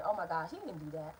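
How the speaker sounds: low, soft inner voice, dropping away; hushed retold thought to oneself; quiet self-reassurance. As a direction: talk yourself down under your breath. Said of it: "And I'm thinking".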